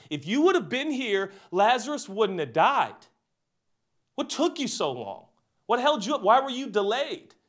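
high-pitched, pleading reproach; distressed reproach. Retold or imitated. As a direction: act out a grieving sister demanding an explanation. Mary reproaches Jesus on arrival.

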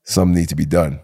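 low, understated action hero. Shown as a demonstration. Friend showing off his action hero voice, low and serious. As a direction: drop low like a movie hero. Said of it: "this is action hero voice".

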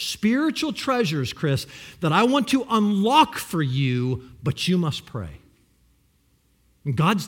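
usual speaking voice

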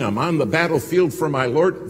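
rousing the congregation with the hymn line; preaching shout. Real, not acted. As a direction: call it out to the church. Cites a hymn.

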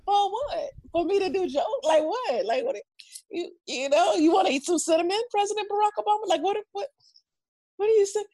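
high, incredulous questions; hammed-up stunned questions; mock bafflement. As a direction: play it baffled and overwhelmed. Retold baffled reaction, high.

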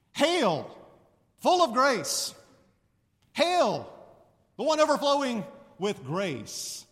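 loud, slow proclamation with real punch; formal, punchy hail. Shown as a demonstration. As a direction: proclaim it like a royal herald. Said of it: "a little bit more punch than just".

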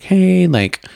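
chirpy hey. Retold or imitated. Messaging them, acted cheery quote.

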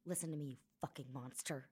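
ordinary delivery

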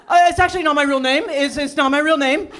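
babbling awkward admission; blurted nervous confession. Retold or imitated. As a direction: spill it out fast and awkward. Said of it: "But instead I just went".

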